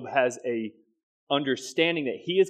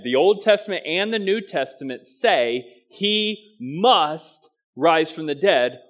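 "must rise" slowed right down, higher and louder; stress on must; 4 semitones higher, 6 dB louder, slower than usual. Shown as a demonstration. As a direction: hammer "must" home, word by word. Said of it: "where I want to put the emphasis".

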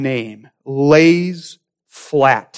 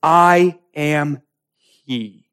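very slow, deliberate words; solemn declaration; much slower than usual. Acted. Jesus naming himself to the soldiers, very slow and solemn. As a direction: let each word stand alone. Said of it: "Jesus speaks the words".

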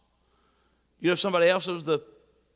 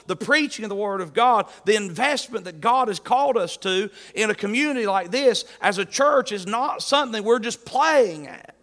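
louder, quicker sermon build; fiery preaching; 4 semitones higher, 4 dB louder than usual. Acted. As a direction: hammer home the point. Preacher rises to full force.

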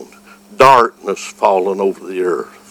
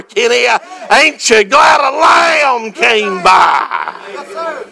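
preaching; 14 semitones higher than usual. Acted. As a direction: preach it out with full fire. Sermon climax, shouted praise.

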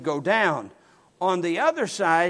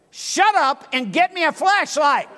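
loud, much higher barked order; angry order; 10 semitones higher, 7 dB louder than usual. Retold or imitated. Pastor ordering the teen boy to fetch a flashlight, loud and angry. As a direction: snap it at the kid. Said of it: "retold exchange with the teen boy".

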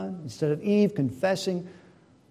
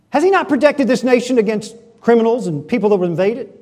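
loud, fast, high interrogation; =acted pressuring demand; browbeating question; 4 semitones higher, 9 dB louder, faster than usual. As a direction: drive the question at him forcefully. Jezebel pressing Naboth, acted.

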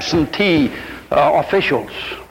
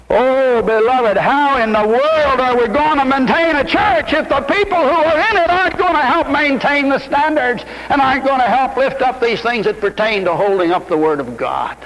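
preaching at full force; 7 semitones higher than usual. Acted. Preacher rousing his congregation to prayer and preaching, full-force sermon cry. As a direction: call the whole church to prayer.

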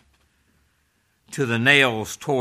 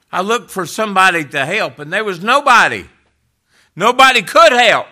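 loud, high, rising desperation; the forsaken one crying for help; anguished cry; 9 semitones higher, 11 dB louder than usual. Acted. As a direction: cry out in despair, building louder. Voicing Christ's anguish, crying out.

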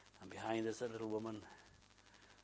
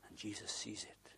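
soft unvoiced whisper, slowing down; hushed reading; 2 semitones lower, 9 dB quieter than usual. A narrator's reading. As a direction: let the verse land gently. Climax of the miracle story.

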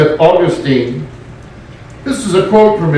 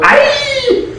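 mock cry of defeat. Army routed at Ai.

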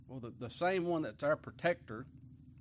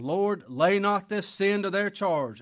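slow, loud, higher cry to heaven; 6 semitones higher, 10 dB louder, slower than usual. Acted. Stephen crying out to God as he is stoned, slow and loud. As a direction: cry it out slowly with full voice. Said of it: "cried with a loud voice".

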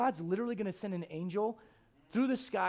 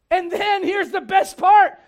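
excited build; 9 semitones higher, 14 dB louder, slower than usual. Real, not acted. Building to the punchline.

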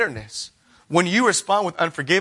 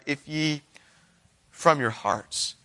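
hushed solemn emphasis; 2 semitones lower, 5 dB quieter, slower than usual. Acted. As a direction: drop the voice to make it sink in.

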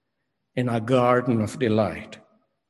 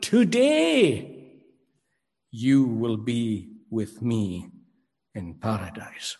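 "Today" leads the sentence, slowly; stress on Today; 3 semitones lower, slower than usual. Shown as a demonstration. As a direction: open on a ringing "Today" and let the promise settle. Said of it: "today is the first word".

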